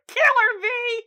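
high, loud cheering-on yell; Fiona. Retold or imitated. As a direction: yell it like a friend egging on a fight. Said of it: "Fiona in the background is like".

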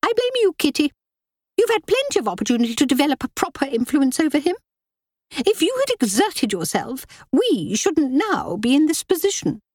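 high, sharp scolding voice; rebuking. A narrator's reading. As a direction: hurl the blame at her. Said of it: "threw the rest of the rebuke".

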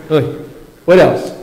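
normal talking voice

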